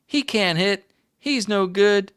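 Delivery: high mocking kid voice; taunting kids. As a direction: jeer like a kid in the outfield. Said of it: "the kids in the outfield would say".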